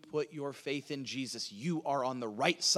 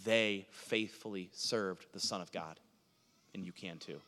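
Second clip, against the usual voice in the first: usual speaking voice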